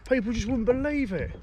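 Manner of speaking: high, thrilled and amazed; gasping with disbelief; excited disbelief. Real, not acted. Pike nearly landed.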